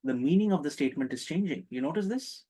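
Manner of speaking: usual speaking voice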